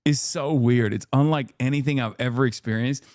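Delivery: ordinary talk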